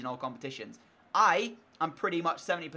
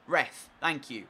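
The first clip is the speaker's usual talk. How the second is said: normal talking voice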